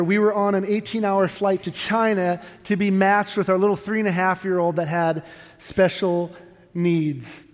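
ordinary delivery

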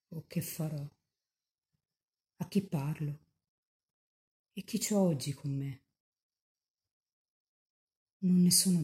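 hushed, devout pleading voice of a mystic speaking to the Virgin; saint in ecstasy. Acted. A young saint in ecstasy tenderly consoling the grieving Mother of Jesus.

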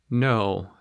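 plain reading voice

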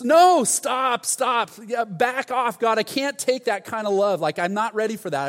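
high, shouted plea to back off; overwhelmed protest. Retold or imitated. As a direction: cry out as if fending off too much. Acts overwhelmed plea to God.

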